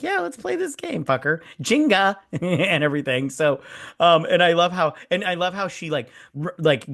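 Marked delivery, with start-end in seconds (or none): 0.0-2.2 high-pitched mock enthusiasm; sarcastic. Showing Gemma how to answer the game, sarcastic. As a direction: play along sarcastically, voice shooting way up. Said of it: "be a little sarcastic".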